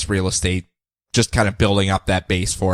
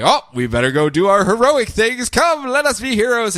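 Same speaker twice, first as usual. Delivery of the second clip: high, hammed-up heroic call; rally like a cheesy hero; mock-grand summons; heroic Kent; 11 semitones higher than usual.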